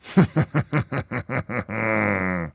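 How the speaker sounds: long rhythmic chuckle; laugh. Real, not acted. Radio host after his KGB joke, long chuckle. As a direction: laugh in a steady run of pulses.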